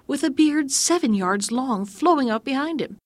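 plain reading voice

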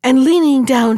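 ordinary delivery, nothing marked

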